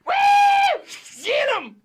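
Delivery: high, loud rally whoop; mock MAGA squeal. Friend joining the MAGA impression, high squealing cheer. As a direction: egg on the crowd in a squealed shout.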